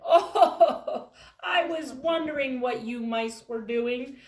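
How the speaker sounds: loud, high amused chuckle through the words; amused booming elephant; laughing elephant. Acted. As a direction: laugh kindly at the little mice. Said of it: "the thing reared up and said".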